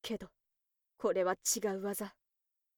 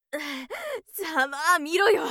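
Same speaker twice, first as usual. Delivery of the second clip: slow, mocking, higher; gloating taunt; 5 semitones higher, 4 dB louder, slower than usual. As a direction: savor the insult. Gloating over the hurt man.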